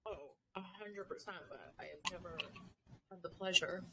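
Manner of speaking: very faint, far from the mic; barely audible. Real, not acted. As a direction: speak almost too softly to hear. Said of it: "I can barely hear you on here".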